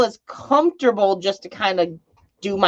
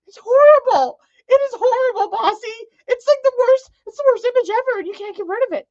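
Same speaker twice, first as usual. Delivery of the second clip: disgusted squeal; faster than usual. Real, not acted. Disgusted by gross video.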